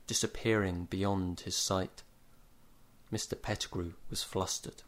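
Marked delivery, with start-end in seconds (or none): 3.1-4.8 whispered. A narrator's reading. Narration turns hushed.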